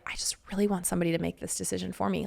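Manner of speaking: quick, breathless whisper; whispered wish. Retold or imitated. Wife in a failing marriage wishing someone would decide for her, quick whisper. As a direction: confess it in a fast hushed whisper.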